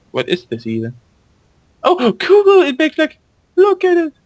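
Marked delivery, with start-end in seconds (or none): 1.8-4.1 much higher, louder delighted squeal; real thrill at something cute; gasping, high-pitched delight; excited gasp. Real, not acted. Excited at pet in game.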